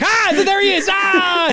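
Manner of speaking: loud, very high-pitched shriek; mock crowd excitement. Fan acts out everybody spotting the hero, high excited yelling. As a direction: yell out like a startled, thrilled crowd.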